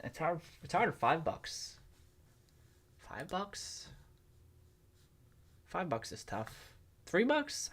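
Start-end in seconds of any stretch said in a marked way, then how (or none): 3.0-3.9 doubtful question. Real, not acted. Doubting his own price target. 5.7-6.7 resigned. Real, not acted. Settling on a verdict. 7.1-7.7 sharply raised incredulous question; surprised. Real, not acted. Weighing a lower price.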